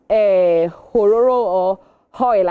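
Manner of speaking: Hororo and Hoil drawn out slowly in a put-on regional accent; mocked accent sounds. Presenter imitating how people mock a regional accent, slow and drawn out. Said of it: "shame people for saying October".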